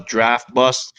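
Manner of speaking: usual speaking voice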